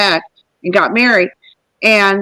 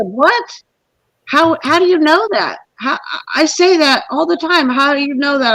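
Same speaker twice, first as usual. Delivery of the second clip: retold disbelief, pitched up; astonished questioning; 5 semitones higher than usual. Woman retelling her reply to the homeless guy, astonished. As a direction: reenact a baffled "how could you possibly know".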